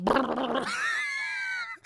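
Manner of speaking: long, startling buzz; imitated buzzer. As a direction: blare like an office buzzer. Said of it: "the whole thing goes".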